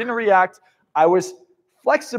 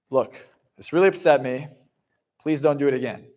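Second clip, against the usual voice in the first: calm restraint; 7 semitones lower than usual. Shown as a demonstration. Telling someone calmly they upset you, restrained. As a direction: keep it calm and controlled though upset.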